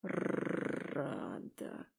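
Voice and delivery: low, soft growled wolf voice; hushed beastly purr-growl; rumbling purr. A narrator's reading. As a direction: rumble it with a rolled R. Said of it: "проурчал он".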